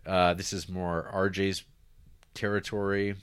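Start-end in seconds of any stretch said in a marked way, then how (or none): none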